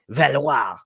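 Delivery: "Valois" drawn out slowly in a French accent. Shown as a demonstration. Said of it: "let me get my French accent".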